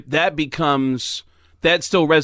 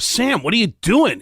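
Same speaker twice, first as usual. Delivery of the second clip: higher, scolding retold cry; 7 semitones higher than usual. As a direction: call him out, dismayed. Retold story, crowd scolding Sam.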